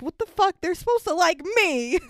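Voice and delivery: high, whiny, indignant champ; whiny Apollo. Retold or imitated. Describing the press conference, a podcaster imitates annoyed Apollo with a high, petulant mock whine.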